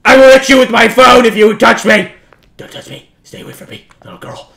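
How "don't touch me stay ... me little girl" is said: hushed, breathless threat; hiding, whispering warnings. Real, not acted. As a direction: whisper so she won't hear. Hiding from the ghost girl.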